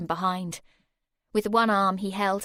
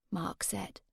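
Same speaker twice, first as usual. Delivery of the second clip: ordinary delivery, nothing marked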